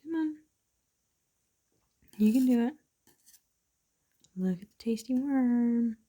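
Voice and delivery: encouraging singsong to a pet; coaxing a pet. Real, not acted. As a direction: coax a reluctant pet to eat. Coaxing pets to eat.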